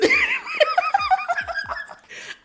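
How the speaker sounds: long, very high cackle; high-pitched laugh. Real, not acted. Vlogger cracking up over a movie crush, squealing laughter.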